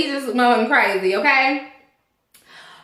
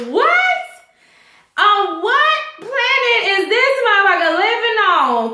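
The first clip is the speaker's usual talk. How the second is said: a high, incredulous What, then outraged asking; shocked, can't believe what he said; stunned disbelief; 6 semitones higher than usual. Retold or imitated. Vlogger's own disbelief.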